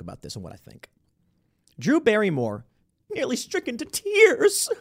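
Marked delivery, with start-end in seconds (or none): 3.1-4.7 mock weepy. Mocking Barrymore's emotion.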